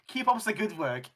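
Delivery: put-on parroting "Keep up the good work" in a jokey German accent; mock German accent. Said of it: "[name] went, went German".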